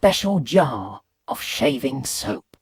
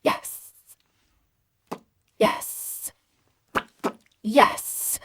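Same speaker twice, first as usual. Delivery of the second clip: voiceless, hissy, last one voiced higher; gleeful hissed yes; 5 semitones higher, 3 dB quieter, much slower than usual. Boy flicking water at mirror, hissed triumph. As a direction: savour each "yes", drawing out the s.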